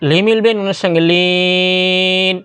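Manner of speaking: লিন drawn out long in chant; slow chanted লিন; slow recitation. Shown as a demonstration. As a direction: stretch the end like Quran reading. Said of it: "এখানে চার আলিফ".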